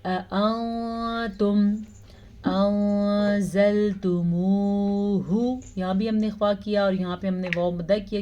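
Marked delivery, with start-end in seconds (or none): 0.0-5.6 slow melodic tajweed chant; measured Quranic recitation. Shown as a demonstration. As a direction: recite slowly, drawing out each vowel.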